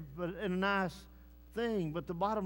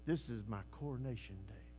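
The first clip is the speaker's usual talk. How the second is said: much softer and lower, quick reverent murmur; hushed awe; 10 semitones lower, 11 dB quieter, faster than usual. Retold or imitated. Believer on his deathbed, softly awed. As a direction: breathe it out in quiet wonder. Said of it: "and say".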